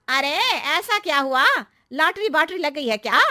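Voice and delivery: high, loud, nosy surprise; pry like an astonished aunty; curious neighbour aunty. Acted. Narrator voices neighbour aunty. (हैरान पड़ोसन aunty)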